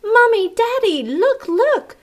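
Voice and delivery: high, loud excited shout; children shouting. Acted. Children shouting for Mommy and Daddy in a high loud voice. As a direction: call the parents urgently. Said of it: "they began shouting".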